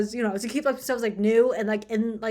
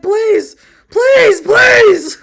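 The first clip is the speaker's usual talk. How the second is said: high, loud pleading shout; mock begging; 11 semitones higher, 16 dB louder, much slower than usual. Imitating Craig begging, shouted mock plea. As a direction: beg at the top of your lungs, hamming it up. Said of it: "Craig is begging".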